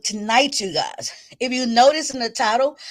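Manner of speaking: ordinary delivery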